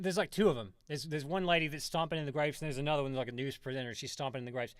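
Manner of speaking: usual speaking voice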